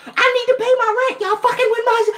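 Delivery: high, laughing, exasperated squeal at the bank; laughing outraged high-pitched rant; pissed-off squeal. Retold or imitated. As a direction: shriek it through a laugh, fed up. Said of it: "I was pissed".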